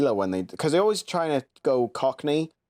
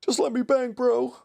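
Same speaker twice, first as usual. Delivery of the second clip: high, squeaky mock pleading; meme quote. Quoting a viral fighter clip to a friend, high mock pleading. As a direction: pitch it way up high, mimicking a whiny fighter.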